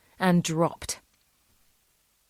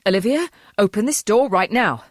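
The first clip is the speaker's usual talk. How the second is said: louder, panicked furious demand; angry; 3 semitones higher, 6 dB louder than usual. Acted. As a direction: bark the order in a panic. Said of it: "His voice sounded angry".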